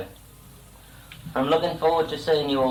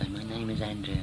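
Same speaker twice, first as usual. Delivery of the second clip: quiet prompting; 3 semitones lower, 9 dB quieter than usual. Real, not acted. Parent softly prompts child's words.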